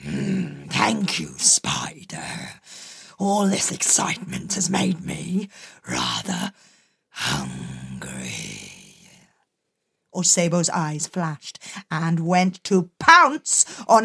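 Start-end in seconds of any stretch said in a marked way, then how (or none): 0.0-8.7 low whispered predator growl; menacing leopard. Acted. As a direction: purr the threat, low and hushed.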